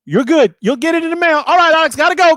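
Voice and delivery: loud, very high sign-off; high-pitched hurried goodbye; brisk manager. Retold or imitated. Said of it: "And he's like".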